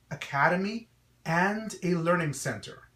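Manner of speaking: usual speaking voice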